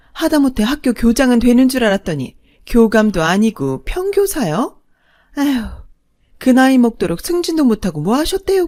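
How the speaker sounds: high, loud sneering taunt; snide second mother. Acted. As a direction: look down on them with a sneer. Second mother belittles family. (비웃는 둘째 어머니 목소리)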